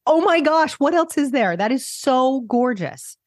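can't hold back the thrill; real excitement spilling over; excited. Real, not acted. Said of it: "hear my voice get all excited".

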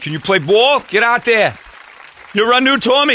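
high, loud eager mock fans; hammed-up Boston fans begging. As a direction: plead with Larry like desperate fans. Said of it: "they'd be like".

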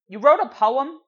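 mock surprise. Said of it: "I thought this was going towards".

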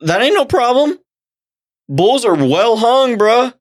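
high-pitched, amazed assurance; excited insistence. Real, not acted. Convincing co-host that bulls are big enough, excited and insistent.

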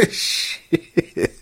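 ordinary talk, nothing marked

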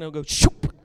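a quick imitated crash noise; playful vocal crash; shoop sound effect. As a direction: make the sound of the podium collapsing. Sound of podium breaking.

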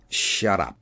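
low, curt command at moderate volume; cutting interruption. A narrator's reading. Kinnison silences blustering Keller, cutting. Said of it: "Kinnison's voice cut".

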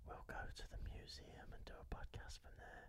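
very soft, voiceless whisper. Pretend. Podcaster sharing a secret idea with his co-hosts, whispered. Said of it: "let me whisper".

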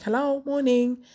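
high, lilting hello; professional phone greeting. Retold or imitated. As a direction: re-enact a courteous work pickup. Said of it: "very professionally".

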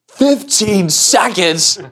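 high, very slow incredulous echo; appalled little kid. Retold or imitated. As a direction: drag it out like a kid facing the impossible. Littlest kid appalled at fifteen seconds.

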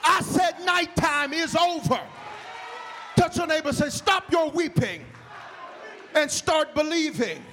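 high, loud, slow shouted preaching; loud, high, slow preaching to the whole church; full-force preaching. Acted. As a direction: preach it out to the back row.

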